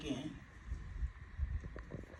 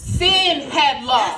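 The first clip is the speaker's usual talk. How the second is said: loud, drawn-out, higher declaration; triumphant proclamation; 4 semitones higher, 7 dB louder, slower than usual. Acted. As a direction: declare victory slowly and forcefully to the congregation. Victory proclaimed to cheering congregation.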